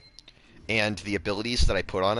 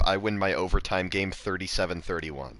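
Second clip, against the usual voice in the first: usual speaking voice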